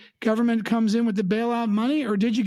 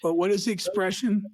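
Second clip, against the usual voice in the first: ordinary talk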